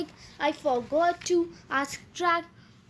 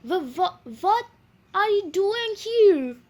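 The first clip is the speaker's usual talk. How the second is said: stuttering start, then a higher, louder burst of shock; dumbfounded stammer; 4 semitones higher, 4 dB louder than usual. A narrator's reading. Stunned mouse to his surprising relatives, stammering shock. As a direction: stumble over the first word, then blurt it out.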